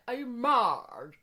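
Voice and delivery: very slow posh English "Are you mad?" with "mad" standing in for "married"; posh English accent. Retold or imitated. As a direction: drawl it out like a posh lady on a houseboat, saying "mad" for "married". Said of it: "But she was so posh".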